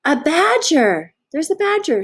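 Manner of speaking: high, bright gasp of discovery; excited discovery. Acted. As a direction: announce the badger with delight. Reading to children, new character.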